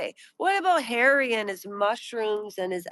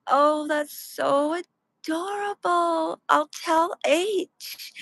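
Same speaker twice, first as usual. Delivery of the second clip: higher, slow, breathy-sounding gushing mock voice after a gasp; imitating Meghan; 6 semitones higher, slower than usual. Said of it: "Meghan said, quote".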